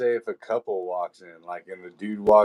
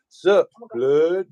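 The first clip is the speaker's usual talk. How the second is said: mock gangster greeting, higher and louder; gang greeting; 8 semitones higher, 5 dB louder than usual. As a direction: toss it off like a street greeting. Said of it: "They're like".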